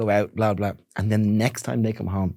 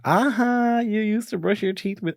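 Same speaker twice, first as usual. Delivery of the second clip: put-on high-pitched childish gotcha; gleeful accusation; 10 semitones higher, slower than usual. Retelling a kid teasing dad, high gleeful accusation. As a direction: crow it like a kid catching someone out.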